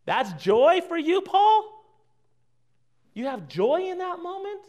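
high, rising disbelieving question; mock incredulity. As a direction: question Paul as if astonished, pitch climbing.

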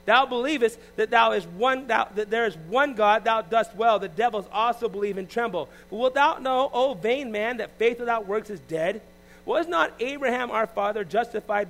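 slow, rising preached reading; fervent scripture reading. Acted. Preacher reading James chapter two, building and fervent. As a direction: build the verse up with fire.

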